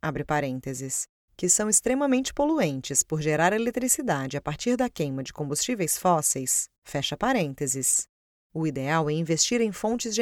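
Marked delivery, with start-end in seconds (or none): 0.0-1.1 low, slow aside voice; dictating. Acted. Reads the brackets aloud. 6.8-8.1 dictating. Acted. Reads the brackets aloud.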